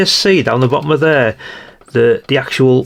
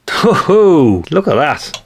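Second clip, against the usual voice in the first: surprised ho-ho; 4 semitones higher, much slower than usual. Real, not acted. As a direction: burst out at the shocking find. Shocked at what's inside the radio.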